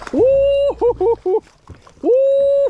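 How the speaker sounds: high, loud whooping cheer; excited whoop. Real, not acted. Angler landing a big bass, loud whoops. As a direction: let out a big triumphant woo.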